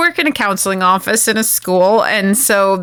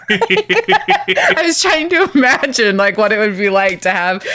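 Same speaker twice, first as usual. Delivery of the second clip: laughing. Real, not acted. Secretary picturing Deanna at work, laughing. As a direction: laugh out the thought. Laughing at her own idea.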